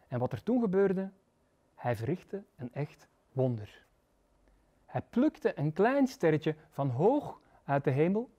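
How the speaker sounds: usual speaking voice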